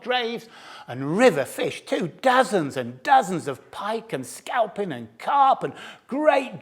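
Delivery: rising, savouring excitement over the feast; relishing delight. A narrator's reading. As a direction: list the fish as if tasting each one. Fox relishing the rich catch.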